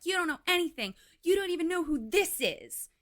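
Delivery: high, loud accusation; angry yelling. Retold or imitated. Daughter accusing the family about Dan, loud and high. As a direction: throw it at the whole family.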